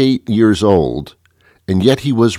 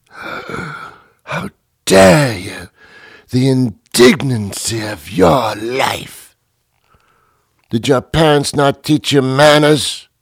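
put-on affronted British accent, dragging out the key words; mock-outraged British gentleman's accent; indignant British accent; 6 semitones higher, slower than usual. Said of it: "we put on a British accent".

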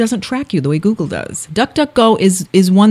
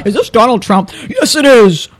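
higher, hammed-up answer to a caller; mock phone exchange; 4 semitones higher than usual. Co-host joking about the wrong-name robocall, hammed-up voices. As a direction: play both sides of a silly call.

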